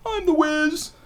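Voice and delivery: Seinfeld quote. Retold or imitated. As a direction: announce yourself like a TV character. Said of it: "Where he's like".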